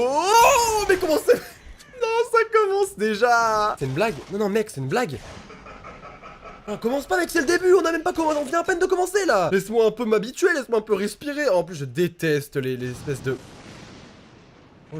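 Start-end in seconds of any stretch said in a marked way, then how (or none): 0.0-3.8 high panicked cry; panicked scream. Real, not acted. Jump scare in VR. 6.7-11.5 high, pleading, rattled; panicked pleading. Real, not acted. Pleading with the game.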